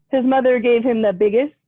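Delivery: ordinary delivery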